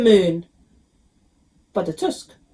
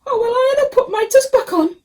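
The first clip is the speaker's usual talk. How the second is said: high crying wail; a child god wailing over his tusk; 14 semitones higher, 4 dB louder than usual. A narrator's reading. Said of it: "Ganesha wailed".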